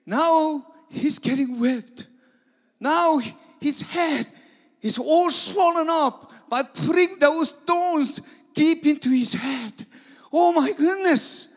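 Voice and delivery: very high, loud anguished cry; loud anguished wailing; desperate panic. Acted. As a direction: plead in despair. Disciples panicking as Jesus is whipped.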